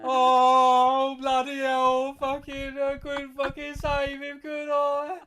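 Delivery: loud, high Cockney wailing; hammed-up sobbing lament; mock crying Alfred. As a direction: bawl it with over-the-top fake grief.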